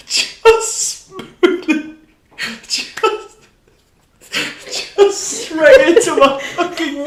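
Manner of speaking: high, slow and loud through helpless laughter; laughing hard. Real, not acted. Telling a friend about the butter in the wound, crying with laughter. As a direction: barely get the words out for laughing.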